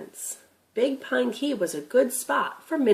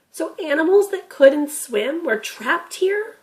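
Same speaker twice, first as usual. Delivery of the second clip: put-on lightly raised, curious boy; a young grandson asking, a bit higher; boy Tim's voice; 8 semitones higher, 6 dB louder than usual. Grandson asking Gramp about the deer, curious boy voice.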